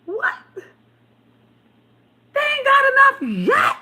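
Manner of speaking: high, loud disbelieving squeal; incredulous. Retold or imitated. Reacting to fans who never get bored, high incredulous squeal. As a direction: shriek it in mock disbelief. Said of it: "I be like".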